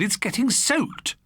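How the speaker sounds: alarmed. Acted. Selina alarmed about the rug.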